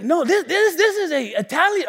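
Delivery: high-pitched stammered objection; insistent mock protest. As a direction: imitate a friend indignantly insisting. Friend protests about the accent.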